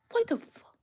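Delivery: breathy high disbelieving whisper; whispered what the fuck; stunned whisper. Real, not acted. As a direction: gasp it out in shock. Stunned reaction while reading comments.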